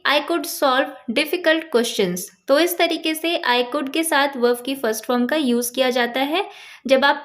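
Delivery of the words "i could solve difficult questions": very slow, carefully spaced English words; slow teaching demo. Shown as a demonstration. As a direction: dictate it word by word to a learner. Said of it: "तो यहाँ पे हम बोलेंगे".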